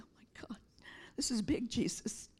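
soft, whispered awe; stunned whisper. Retold or imitated. Pastor retelling her stunned prayer to Jesus, whispered. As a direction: breathe it out as a hushed prayer.

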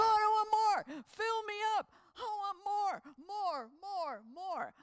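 loud and high, then fading on the repeats. Retold or imitated. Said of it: "going, 'God".

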